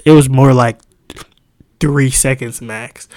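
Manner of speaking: loud, fast, fired-up telling; worked up. Real, not acted. Telling friends about her long pause, loud and worked up. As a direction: relive the pause, full of heat.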